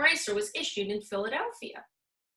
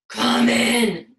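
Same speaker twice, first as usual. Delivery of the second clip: a loud, growled welcome from behind the door; booming wolf voice; growly voice; 10 dB louder than usual. A narrator's reading. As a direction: bark it like a wolf pretending to be nice. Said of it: "said a growly voice".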